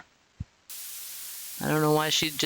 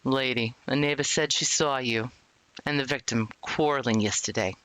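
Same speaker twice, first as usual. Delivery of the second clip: lower-pitched officer, matter-of-fact; police interrogator; 3 semitones lower than usual. Acted. Officer questions a murder suspect, stern low voice. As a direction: play the cop laying out evidence.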